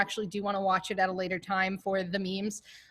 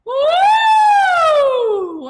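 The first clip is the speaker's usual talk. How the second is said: loud, very high siren swoop; big swooping warm-up whoop; higher vocal siren; 14 dB louder than usual. Shown as a demonstration. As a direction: push the siren up high and slide down loudly. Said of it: "And two more".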